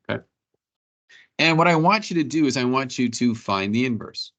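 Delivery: ordinary talk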